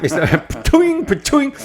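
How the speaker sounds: high springy "pa-chung"; ping it out like a bouncing ball, amused; comic high-pitched ball sound effect; imitated ricochet noise. Imitates the ball-firing patient's noise.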